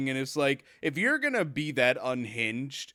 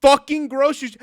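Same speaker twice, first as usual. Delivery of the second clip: loud, raised ranting; angry rant; 4 semitones higher, 7 dB louder than usual. Acted. Ranting at a lazy customer, loud and angry.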